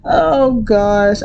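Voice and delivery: usual speaking voice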